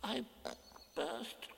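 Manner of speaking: soft halting squeak, broken by long pauses; Piglet distressed. A narrator's reading. Piglet confessing he burst the balloon, faltering squeak.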